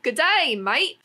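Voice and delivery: hammed-up Australian "Good day, mate!", high and loud; Australian accent. Australian accent, mocking the brand's Aussie-themed slogan. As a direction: bellow it like a cheery Aussie. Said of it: "kinda like an Australian".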